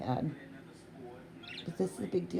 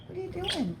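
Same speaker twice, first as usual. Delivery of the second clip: much higher squeaky pet voice; 10 semitones higher, faster than usual. Real, not acted. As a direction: coo it up high to the dog. Coaxing the dog, higher.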